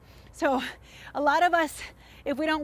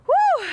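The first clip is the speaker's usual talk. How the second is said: shouted woo, high and loud; loud high woo cheer; 9 dB louder than usual. Real, not acted. Cheering end of set.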